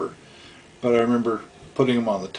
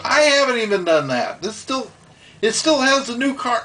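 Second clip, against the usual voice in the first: Kyle protesting; 12 semitones higher than usual. Retold or imitated. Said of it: "He goes".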